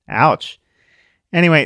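usual speaking voice

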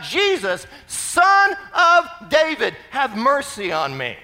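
loud plea swelling to a high, drawn-out middle; crying out. Acted. Blind Bart calling on the Son of David, crying out. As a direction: call out slowly for mercy. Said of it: "So he's crying out".

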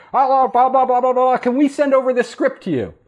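lightly voiced chirpy phone caller, pitched high; breezy agency caller rattling off blah blah; high mock caller. Agency caller on the phone to the new hire, high mock voice.

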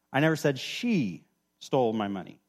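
single out "she" and hang on it; stress on SHE. Shown as a demonstration. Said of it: "emphasize a different word every time".

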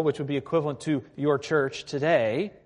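ordinary talk, nothing marked

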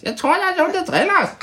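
very high, squeaky little voice; high-pitched teddy voice. Shown as a demonstration. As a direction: squeak it out like a tiny creature.

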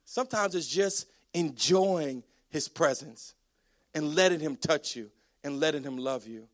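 calm and intimate, with unhurried pauses; tender, hushed reassurance. Real, not acted. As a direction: ease gently into each phrase. Gentle contrast after the screaming.

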